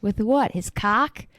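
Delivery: mobster voice. Retold or imitated. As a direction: scoff it like a tough guy. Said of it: "the other guy goes".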